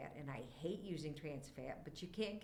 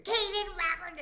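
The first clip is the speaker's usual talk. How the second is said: high, loud full-voiced scream of a name; mom's scream; 13 semitones higher, 11 dB louder than usual. Re-enacting a game mom screaming at her son, loud high scream. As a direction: belt the son's name like an angry mom across the house. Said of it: "So I get to scream".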